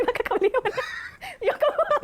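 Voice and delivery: stunned giggle at an absurd demand; laughing at a stranger's demand for money; laughing surprise. Retold or imitated.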